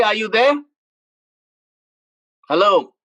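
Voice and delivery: ordinary talk, nothing marked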